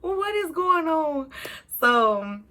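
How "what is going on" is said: high, slow, crying whine; retold crying. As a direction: wail it like a lost, crying kid. I start crying because I'm like.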